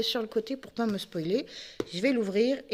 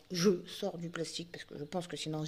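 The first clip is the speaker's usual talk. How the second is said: usual speaking voice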